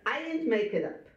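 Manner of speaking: usual speaking voice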